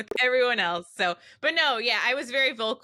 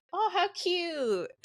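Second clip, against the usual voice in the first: high, soft, drawn-out coo; sudden cooing; 7 semitones higher, 4 dB quieter, much slower than usual. Retold or imitated. Friend mimicking herself switching off a rant, cooing. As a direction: coo slowly, as if the rant never happened. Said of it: "And then I'm like".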